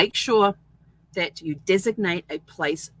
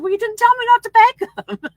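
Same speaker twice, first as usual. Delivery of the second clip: high and loud, bursting with laughter; giggling retort to husband over the packed pants; laughing through the words; 10 dB louder than usual. Retold or imitated. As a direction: blurt it out while cracking up. I cracked up laughing.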